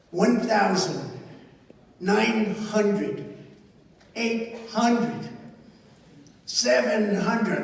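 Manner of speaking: a hint of a cockpit computer voice; lightly voiced computer callout counting down; mechanical voice. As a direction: count the altitude down like an automated callout. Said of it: "they call it a mechanical voice".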